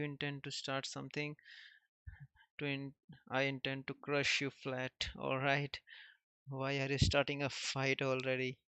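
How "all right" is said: usual speaking voice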